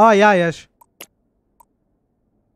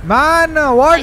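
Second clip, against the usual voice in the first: frantic; 8 semitones higher, 7 dB louder, slower than usual. Real, not acted. Frantic in overtime fight.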